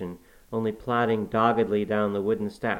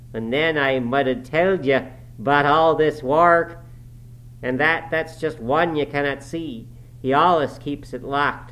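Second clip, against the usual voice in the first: put-on rough, louder dialect voice of an old servant; gruff Yorkshire servant; 5 semitones higher, 5 dB louder than usual. A narrator's reading. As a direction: grumble it like a surly farmhand. Joseph's dialect voice.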